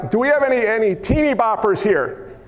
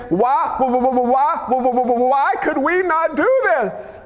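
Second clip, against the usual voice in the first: stammering, rising into a slow high plea; acted frustrated whine; 3 semitones higher, slower than usual. Disciples asking Jesus about failing, stammering high wail. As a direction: stutter on "why", then wail the question. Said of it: "the Greek again".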